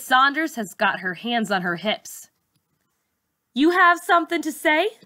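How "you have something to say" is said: challenging teacher. A narrator's reading. Teacher confronting Charlize, sharp challenge. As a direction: dare her to answer.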